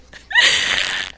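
a high loud burst of laughter; real laughter at her own story; shrieking laugh. Real, not acted. Laughing about her smart mouth.

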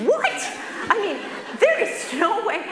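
can't believe what she hears; disbelief. Retold or imitated. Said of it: "I'm like".

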